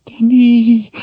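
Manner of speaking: breathless cry of fear; gasped. Acted. Kaelin gasps her dead sister's name in terror. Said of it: "she gasped".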